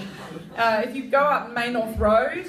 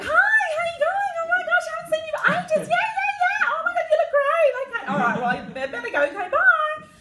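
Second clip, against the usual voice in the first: very high, squealing over-the-top greeting; gushing best friend; 5 dB louder than usual. Retold or imitated. Greeting a best friend in the street, squealing and high. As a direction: shriek with fake delight at an old friend. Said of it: "And you're like".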